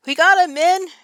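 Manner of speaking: loud triumphant shout. A narrator's reading. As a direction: call it out to the men. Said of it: "shouted the leader".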